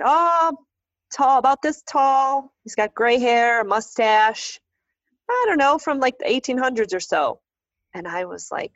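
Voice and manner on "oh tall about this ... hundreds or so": a hint of a drawling caretaker; folksy groundskeeper. Groundskeeper reassuring the family, an easygoing folksy voice.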